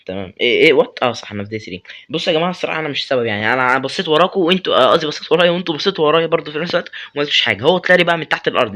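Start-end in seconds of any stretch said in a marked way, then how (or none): 2.1-8.8 fast, loud defensive babble; rushed excuses. Real, not acted. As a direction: rattle off excuses to friends.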